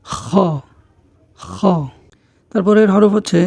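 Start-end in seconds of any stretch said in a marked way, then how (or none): 0.1-1.6 খ as a scraped "kho" from the throat; throat-clearing খ. Shown as a demonstration. Teacher models the Arabic letter, rasped throat sound in খ. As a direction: scrape খ as if clearing something stuck. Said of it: "ঠিক তেমনিভাবে উচ্চারণটি হবে".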